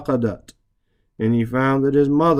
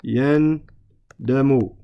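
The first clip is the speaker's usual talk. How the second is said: yandamu drawn out slowly, split as yan, damu; slow teaching breakdown of yandamu; syllable by syllable; much slower than usual. Shown as a demonstration. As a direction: say yandamu very slowly in separate parts.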